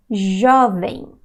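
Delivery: Jovem said the Brazilian way; Portuguese jovem. Shown as a demonstration. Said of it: "See how I say it?".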